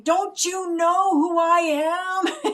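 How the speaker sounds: high, loud, slow outraged wail; angry dissolving villain. Retold or imitated. Imitating the melting villain, an outraged slow wail. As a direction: drag it out as you're being destroyed. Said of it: "he's so angry as he's being taken out".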